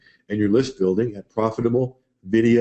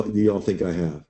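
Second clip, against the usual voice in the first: ordinary talk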